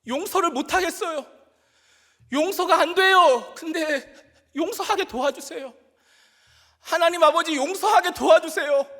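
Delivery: loud, high shout breaking into a pleading prayer; tearful shouting. Retold or imitated. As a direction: cry out to God through tears. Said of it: "눈물을 흘리며 소리치는".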